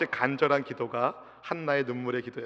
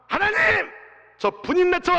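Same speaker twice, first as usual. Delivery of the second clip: 하나님 cried out much higher and louder, like an angry demand; a wronged woman crying out for her rival to be cast out; vengeful cry; 13 semitones higher, 3 dB louder than usual. Acted. Hannah crying out to God against her rival, angry.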